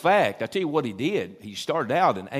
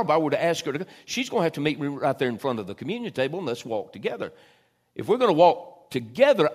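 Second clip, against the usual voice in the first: fervent preaching; 5 semitones higher, 6 dB louder than usual. Acted. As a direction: preach it louder, urging the church. Preaching the wedding image.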